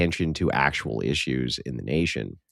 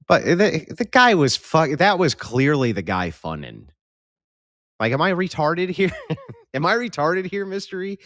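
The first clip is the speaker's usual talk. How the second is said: incredulous; 9 semitones higher than usual. Real, not acted. Incredulous, high, loud.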